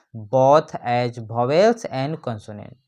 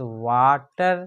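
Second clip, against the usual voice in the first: Water drawn out slowly as वा - टर; split into syllables; 2 semitones lower, much slower than usual. Shown as a demonstration. Teacher giving a two-syllable example, water split slowly in two. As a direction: say water in two clear beats. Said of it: "टू syllable वाले word हैं".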